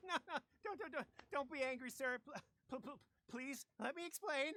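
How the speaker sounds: high nervous laugh then stammered begging; nervous pleading. Acted. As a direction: grovel to the soldier, flustered. Thug begging soldier nervously.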